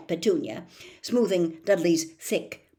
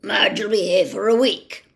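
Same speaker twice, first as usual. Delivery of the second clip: loud snarling uncle; snarled; 3 semitones higher, 9 dB louder than usual. A narrator's reading. Uncle snarling the news at Harry, loud. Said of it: "Uncle Vernon snarled".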